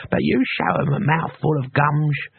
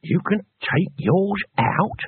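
slow, higher toothless amazement; awed; 6 semitones higher, slower than usual. A narrator's reading. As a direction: marvel slowly, pitched up, without teeth. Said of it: "Cohen's very voice radiated awe".

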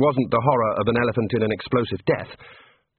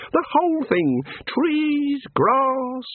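high, bright small-god voice; cheerful little god; 13 semitones higher, slower than usual. A narrator's reading. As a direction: play an eager little craftsman god. God character voice.

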